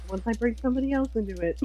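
ordinary talk, nothing marked

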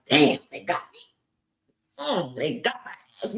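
mock wounded muttering, low; stung reaction. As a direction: act out taking a hit, grudgingly. Acted reaction to a stinging insult.

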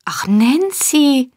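high, drawn-out cry of shock; horrified cry. A narrator's reading. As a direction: cry the name slowly, aghast. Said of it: "schrie Oliver, entsetzt zurückfahrend". (entsetzter Aufschrei)

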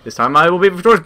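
ordinary delivery

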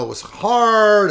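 mock whining, loud and drawn out; imitating a complaint. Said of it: "you know, complained".

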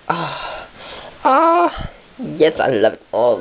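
ordinary delivery

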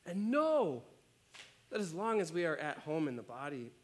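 measured, earnest reading of a Bible passage; scripture reading. Acted. Pastor reading scripture to the church, earnest and measured. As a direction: read the verses out to the congregation.

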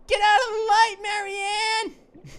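loud cry at a character; yelling. Pretend. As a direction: shout her name, urgent.

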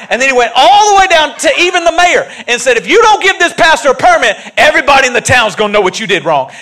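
loud, rising preacher build; full-force preaching. Real, not acted. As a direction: preach it hard to the crowd.

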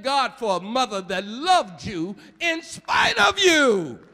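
loud, driving preacher cadence; preaching shout. Real, not acted. Preacher urging the mourners to praise, loudly. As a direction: hammer it home at full force.

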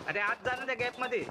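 ordinary delivery

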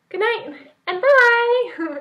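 very high, slow, drawn-out bye; sing-song goodbye. Real, not acted. Signing off the vlog to viewers, very high and slow. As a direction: stretch the goodbye out, bright and loud. Sign-off to camera.

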